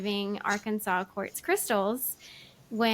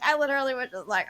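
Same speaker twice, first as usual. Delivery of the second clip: normal talking voice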